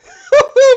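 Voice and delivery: high loud laugh; loud burst of laughter. Real, not acted. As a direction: break into a cackle. Laughing at the clip.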